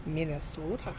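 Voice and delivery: softly mocked Minnesota accent; understated Minnesotan lilt on "Minnesota". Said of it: "people from Minnesota really talk like that".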